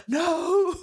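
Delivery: gasp, then a high mock "no"; horrified teacher. Teacher learning about the plaster, mock horror. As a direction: imitate a teacher's appalled reaction. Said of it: "the teacher was like".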